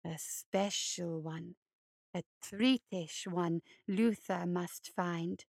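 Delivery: low, slow, creepy hare voice; low creeping monster croon; hulking hare creature. Acted. Luther picks a rabbit.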